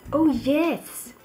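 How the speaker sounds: ordinary talk, nothing marked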